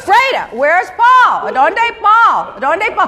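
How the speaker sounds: drawn-out, high demand for an answer; imitated impatient calling. Boss retelling how she asked Freda where Paul was, impatient. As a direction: call out slowly, acting out mounting impatience.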